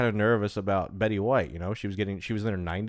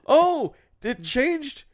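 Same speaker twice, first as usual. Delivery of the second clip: surprised; 4 dB louder, much slower than usual. Real, not acted. Surprise at the page change.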